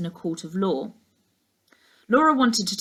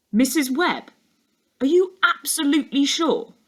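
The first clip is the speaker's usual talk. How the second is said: higher, startled disbelief; surprised; 8 semitones higher, 4 dB louder than usual. A narrator's reading. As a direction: catch him off guard. He stares at her.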